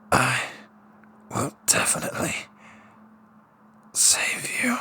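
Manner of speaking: whispered final promise; dying whisper. Acted. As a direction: promise it with your last breath. Dying hero's last vow.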